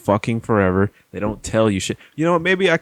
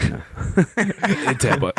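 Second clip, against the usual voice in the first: slow, laughing repeat of in Tampa; drawn-out laughing echo of in Tampa; 3 semitones higher, much slower than usual. Acted. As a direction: echo in Tampa while cracking up. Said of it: "echoing the intro".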